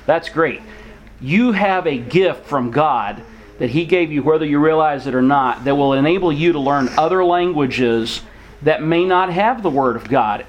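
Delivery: ordinary delivery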